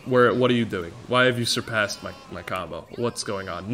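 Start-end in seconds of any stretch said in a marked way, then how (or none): none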